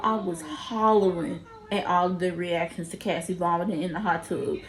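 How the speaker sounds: usual speaking voice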